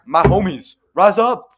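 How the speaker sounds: loud call to arms, rising high; rally the crowd with a big shout. Acted. Abe calling his homies to revolt, shouted.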